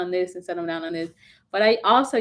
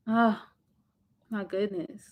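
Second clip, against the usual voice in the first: normal talking voice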